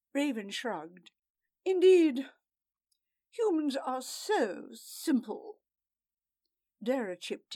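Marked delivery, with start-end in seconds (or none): 1.6-5.6 high, scornful sneer; mock humans. A narrator's reading. Raven sneering.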